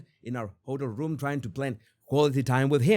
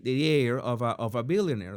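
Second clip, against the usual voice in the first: ordinary talk, nothing marked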